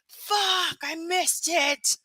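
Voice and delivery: high, dragged-out whine at missing the stream; whiny frustration. Retold or imitated. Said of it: "And I was like".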